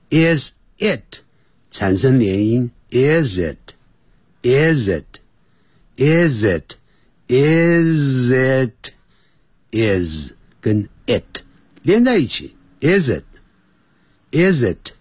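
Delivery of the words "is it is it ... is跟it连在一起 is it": "is it" linked into one word, slow. Shown as a demonstration. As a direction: glide "is it" together as a single word.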